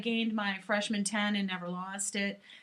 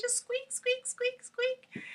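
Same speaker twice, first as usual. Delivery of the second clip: high squeaky chipmunk imitation; slower than usual. Mimicking a friend's sped-up video voice, squeaky and high. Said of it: "I heard your squeaky little voice".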